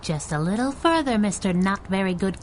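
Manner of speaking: light, lilting taunt; teasing call. Acted. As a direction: coax the quarry closer, mockingly. Taunting a climber from above.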